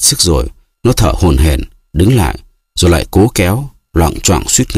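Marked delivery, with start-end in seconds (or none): none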